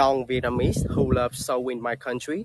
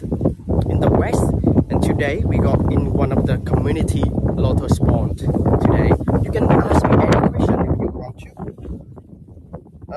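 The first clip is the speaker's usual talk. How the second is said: raised voice against strong wind; shouting over wind; 5 dB louder than usual. Real, not acted. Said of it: "The wind is really loud".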